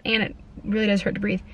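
ordinary talk, nothing marked

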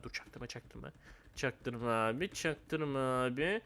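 low, very soft, sneaky aside; stealthy undertone, barely voiced; furtive hush. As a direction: keep it hushed so nobody notices. Sneaking, telling others not to notice.

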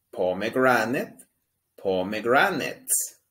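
"pomegranate" slow, each syllable clear; slow model pronunciation. Shown as a demonstration. Teacher modelling a long English word slowly. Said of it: "Pueden ver".

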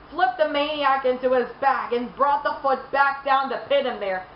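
ordinary talk

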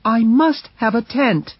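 protest, hands thrown up; Mercedes voiced. A narrator's reading. Mercedes objecting to leaving the tent, indignant. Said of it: "she answered".

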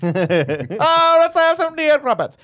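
hammed-up British accent, rising high and loud. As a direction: do a theatrical mock-British princess, building in pitch. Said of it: "she's British all of a sudden".

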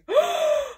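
sharp high gasp of delight; excited gasp. Real, not acted. Unboxing a Sam Rockwell package, a delighted high gasp.

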